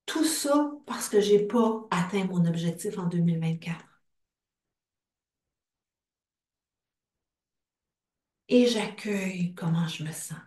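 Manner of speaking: hushed whisper. Acted. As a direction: breathe the words without voice. Tapping script turns intimate.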